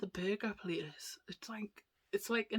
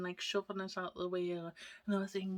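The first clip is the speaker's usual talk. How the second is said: ordinary talk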